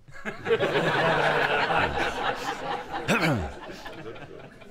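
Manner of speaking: long rising burst of laughter; real laugh. Real, not acted. Tickled by the cat story's punchline, long real laugh.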